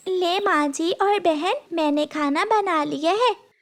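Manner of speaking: put-on high-pitched girlish voice; dutiful little girl. Gudiya tells stepmother and sister the food is ready, dutifully. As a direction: announce it in a high child's voice.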